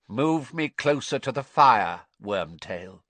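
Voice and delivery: cold, commanding villain voice; icy cold. A narrator's reading. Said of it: "said the cold voice".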